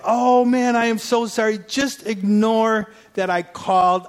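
flustered apology. Retold or imitated. Apologizing in a panic on phone.

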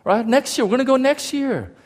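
put-on high-pitched, agreeable child; small high voice, trying to be okay with it; little kid. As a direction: play a kid putting on a hopeful front. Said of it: "I'm a little kid. I was like".

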